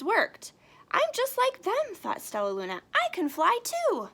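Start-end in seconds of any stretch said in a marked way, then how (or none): 0.9-1.9 put-on high, eager young bat; Stellaluna voice. Reading to kids, Stellaluna speaks in a high eager voice. 2.9-4.1 put-on high, eager young bat; Stellaluna voice. Reading to kids, Stellaluna speaks in a high eager voice.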